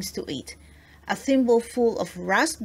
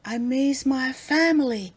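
understated sadness, raised pitch; a touch of homesick sorrow; lightly acted wistful complaint; mildly sad; 5 semitones higher, 3 dB louder than usual. A narrator's reading. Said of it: "said Ernest sadly".

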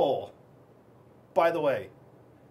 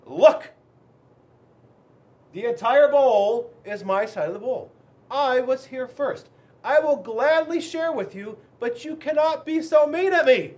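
standing up to Fred; 3 semitones higher, 6 dB louder than usual. Acted. Fish George tells the crab Fred off, loud and indignant. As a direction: push back hard, this is my bowl. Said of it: "and I said".

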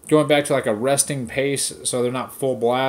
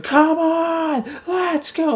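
mock yell; 14 semitones higher, much slower than usual. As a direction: yell it like the instructor in her headset. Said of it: "yelling into it".

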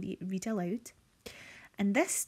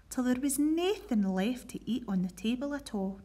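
put-on lively read-aloud storytelling for children; reading a Scots picture book to pupils; story narration. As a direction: tell it brightly to a school assembly. Teacher reads Scots story aloud.

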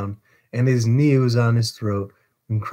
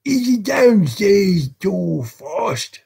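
loud, high, drawn-out demand; fierce, raised questioning; urgent shouted question; 9 semitones higher, 5 dB louder, much slower than usual. A narrator's reading. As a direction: call it out slowly in a ruffian's voice. Sykes urgently checking the house.

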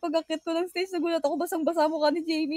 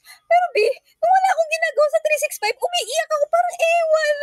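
emotional outburst; 11 semitones higher, 7 dB louder than usual. Real, not acted. Emotional retelling of crying.

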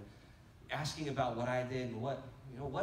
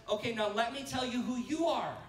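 high, louder quoted declaration; emphatic declaration; 11 semitones higher, 6 dB louder than usual. Retold or imitated. Preacher quotes Jesus answering Peter, emphatic declaration. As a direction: proclaim it, pitched well up.

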